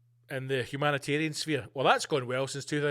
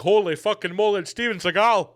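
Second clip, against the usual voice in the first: shocked reporter; 3 semitones higher, 9 dB louder than usual. Pretend.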